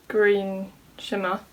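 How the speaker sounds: ordinary talk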